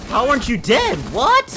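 startled cry at an impossible survival; shocked disbelief. Real, not acted.

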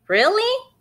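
very high, delighted really; surprised. Acted. Benny delighted at his mom's circus threat, squeaking high. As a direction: sound thrilled by the idea.

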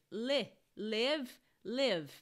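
"live" with a short lax i, close to "lev"; "le, live" said with a short open i. Shown as a demonstration. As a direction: clip the vowel of "live", never "leeve". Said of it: "ele soa como live".

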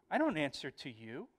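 mildly insolent acted retort; understated mock defiance; defiant. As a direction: refuse to answer, with a touch of cheek. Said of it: "there's a defiant tone".

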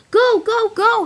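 high, loud cheering yell; excited shout. A narrator's reading. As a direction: cheer the racer on at full voice. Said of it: "he shouted".

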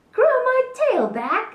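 high, loud incredulous question; astonished. Acted. Gecko asking Dinosaur about growing tails back, astonished. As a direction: squeal it, hardly believing it.

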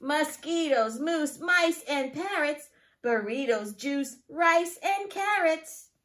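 lively, singsong picture-book reading; reading to children. Acted. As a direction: read it to a class of small children. Teacher reads picture book aloud.

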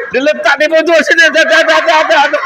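high, loud teasing protest; real amused protest at a filming listener; laughing teasing. Real, not acted. Teasing audience member recording.

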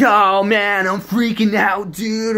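loud, high mock panic; drawn-out, high-pitched shouting; freaking-out raver. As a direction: wail like a hammed-up freaked-out festival guy. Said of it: "I don't wanna be that guy".

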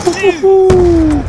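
loud high hooting whoops; excited whooping. Real, not acted. Excited whoops during play.

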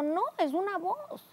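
high, drawn-out insistence; insistent retort. Retold or imitated. Wife correcting husband who suspects a dream, high slow insistence. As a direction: insist slowly, pitched high.

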